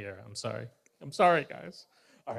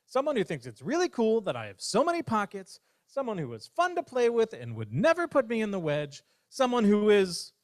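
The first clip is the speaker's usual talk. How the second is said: hopeful rush; 8 semitones higher than usual. Acted. Bear dreams of ideal friend.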